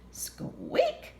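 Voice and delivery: tiny high squeak; mouse squeak. Acted. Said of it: "said Mouse".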